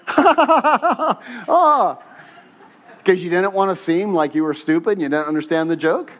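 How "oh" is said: high, loud forced laugh ending in "oh"; fake laugh. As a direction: laugh along as if you got the joke. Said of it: "you didn't get it and you went".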